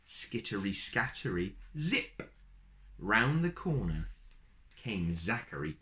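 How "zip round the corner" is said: ordinary delivery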